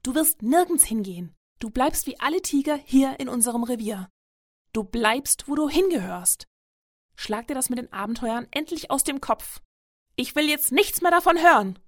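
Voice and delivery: stern mother laying down the law; angry, loud voice. A narrator's reading. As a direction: scold forcefully, building to a loud finish. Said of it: "sagt sie mit lauter Stimme".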